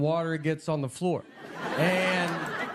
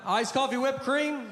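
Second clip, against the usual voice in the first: mock barista call, pitched higher; yelled out; 7 semitones higher than usual. Barista calls the order out to the whole waiting crowd, a mock yell. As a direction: sing it out over the counter, a mild mock yell. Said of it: "She just yells out".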